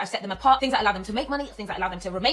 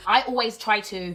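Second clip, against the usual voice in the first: ordinary talk, nothing marked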